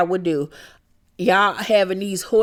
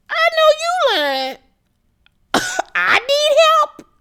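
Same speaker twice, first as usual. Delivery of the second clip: a high, louder squeal of indignant disbelief; mocking disbelief; 4 dB louder than usual. Recapper mocking Lisa's suggestion, high indignant squeal. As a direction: throw it back at her in a shrill mock.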